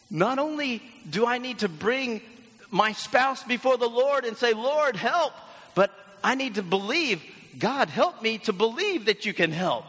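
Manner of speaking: high, loud, hammed-up plea; acted earnest cry to the Lord; praying spouse. Churchgoer praying over a troubled marriage, high emphatic pleading. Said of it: "you're thinking".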